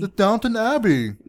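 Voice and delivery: high, hammed-up English accent; bad English accent. Explaining the accent to spouse, bad English accent. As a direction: do a poor Downton-style English accent. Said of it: "Oh, is that English?".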